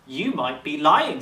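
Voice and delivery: a loud challenge from the Raj; a suspicious king calling out the beast. A narrator's reading.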